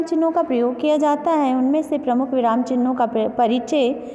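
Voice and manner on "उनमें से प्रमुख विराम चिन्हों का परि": ordinary talk, nothing marked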